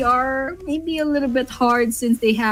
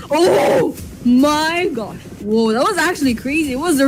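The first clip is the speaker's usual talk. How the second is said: amazed; 4 semitones higher than usual. Real, not acted. Reaction to a great grenade.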